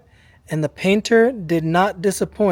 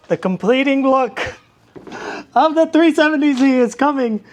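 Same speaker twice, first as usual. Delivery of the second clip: high, louder showman's build-up; hyped-up reveal; 7 semitones higher, 3 dB louder than usual. Acted. Host teasing the finished car before a break, hyped up. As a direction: tease the big reveal, pitched up high.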